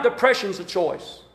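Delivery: "depression's" low and soft; soft aside. Shown as a demonstration. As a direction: drop to an undertone. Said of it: "Depression is a choice".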